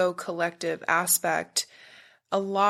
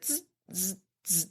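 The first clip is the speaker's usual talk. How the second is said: soft buzzing zzz sound; imitated buzzing; 7 dB quieter than usual. Mind sound shown as a soft buzz. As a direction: buzz it quietly like static. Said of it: "sort of goes like".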